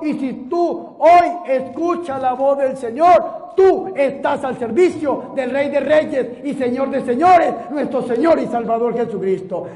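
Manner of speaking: loud, fervent preaching; rally the congregation. Real, not acted. Preacher to his congregation, in loud, fervent preaching.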